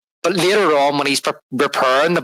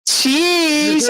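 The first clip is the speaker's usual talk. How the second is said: a squeaky, much higher "Cheese" with a drop at the end; ditzy cheese; 13 semitones higher than usual. Retold or imitated. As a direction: chirp it like an eager airhead posing for a photo. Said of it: "And she's like".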